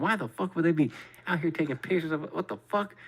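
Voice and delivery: high, bewildered questioning; freaked out. Retold or imitated. Puzzling over a supposed photographer, freaked out and high-pitched. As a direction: climb in pitch into baffled disbelief. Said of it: "He's freaking me the fuck out".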